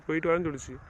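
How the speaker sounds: ordinary delivery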